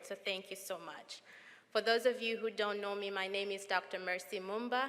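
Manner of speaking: normal talking voice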